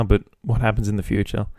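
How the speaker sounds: ordinary talk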